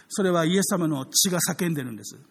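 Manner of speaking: ordinary talk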